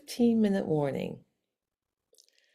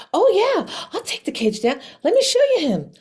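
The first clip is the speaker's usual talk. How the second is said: high, loud, gushing eagerness; suddenly thrilled and eager to help, acted out; all excited; 12 semitones higher, 7 dB louder, faster than usual. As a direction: leap up in pitch and volume. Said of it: "She was, like, all excited".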